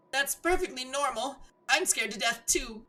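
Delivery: put-on high girl voice. Giselle answering Michael's fear, high young woman voice. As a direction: play a frightened girl gently.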